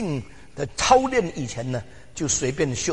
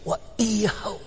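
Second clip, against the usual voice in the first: put-on much higher, eager childlike wishing; voicing a child; 8 semitones higher than usual. Preacher imitating a child listing wishes, pitched high. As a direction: sound like a little kid wanting everything.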